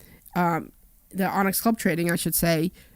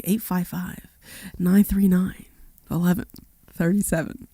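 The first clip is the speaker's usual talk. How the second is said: lightly sultry phone number read; sexy voice. Shown as a demonstration. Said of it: "You gotta do it the sexy way".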